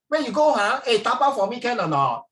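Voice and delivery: high, loud Singlish request; Singlish banter. Acted. Office worker asking a colleague to buy takeaway, loud Singlish. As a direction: call out casually in broad Singlish. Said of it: "you see, you talk like that".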